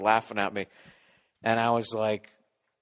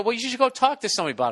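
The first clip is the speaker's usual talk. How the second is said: wife quoted; 9 semitones higher, 4 dB louder, much faster than usual. Retold or imitated. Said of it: "she go".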